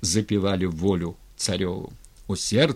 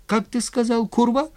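very high, rising indignant question; affronted disbelief; 14 semitones higher, 4 dB louder than usual. A narrator's reading. Styr as tsar hearing himself insulted, affronted.

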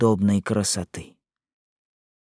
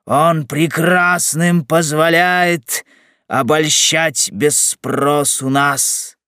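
slow, drawn-out poetic recital; raised-pitch, measured rhyme recitation; verse declamation; 4 semitones higher, 4 dB louder, much slower than usual. Acted. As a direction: declaim the verse grandly, stretching each phrase. Recites a comic verse decree.

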